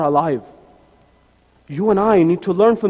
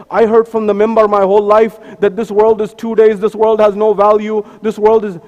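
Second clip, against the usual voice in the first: usual speaking voice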